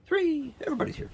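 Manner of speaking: high cooing count of the kittens. Real, not acted. Farmer counting kittens in high baby talk. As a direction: coo the count.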